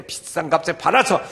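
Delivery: loud protest, easing at the end; acted indignant disciples. Disciples objecting to the costly perfume, acted indignation. 제자들이 옆에 있다가.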